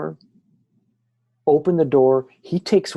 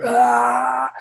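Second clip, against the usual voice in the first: high, drawn-out mock scream; imitated scream; 9 semitones higher than usual. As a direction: scream it without full volume. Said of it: "Screams it".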